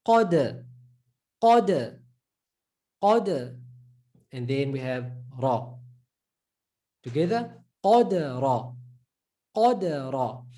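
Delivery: Qad with a full qaf and a jerked dal; teacher drilling Qadara; Arabic syllable drill. Shown as a demonstration. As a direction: Qadara with a round heavy Qa. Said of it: "plus the qa before it".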